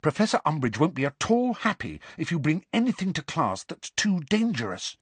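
high-pitched earnest warning; pressing, high girl's voice; urgent. A narrator's reading. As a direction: warn him plainly and urgently. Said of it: "said Hermione urgently, dropping all pretense".

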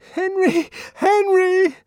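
high, loud, panicked cry for help; terrified shout. A narrator's reading. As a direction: scream for help in terror. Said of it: "she shouted".

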